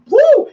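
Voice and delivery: loud, high belted "woo"; sudden full-voiced whoop; pushed "woo". Shown as a demonstration. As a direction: push a big whoop from the diaphragm. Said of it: "And you push it through".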